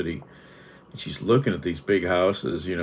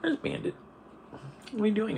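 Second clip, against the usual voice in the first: much higher, softer sing-song call for the cat; high pet voice; 8 dB quieter, much faster than usual. Real, not acted.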